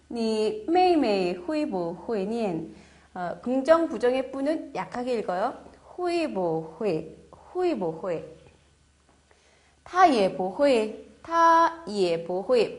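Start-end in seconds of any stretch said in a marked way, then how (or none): 0.1-2.7 each syllable of 会不会 stretched slowly; slow model reading. Shown as a demonstration. Teacher modelling the question for students, slow. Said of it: "이렇게 되면 말을 안 써도 의문이 된다". 9.8-12.8 each syllable of 会不会 stretched slowly; slow model reading. Shown as a demonstration. Teacher modelling the question for students, slow. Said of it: "model sentence read out".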